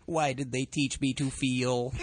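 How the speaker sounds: slow, stiff, mock-hurt robot. Robot reacts to being told off, robot voice. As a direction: lament like a machine burdened with emotion.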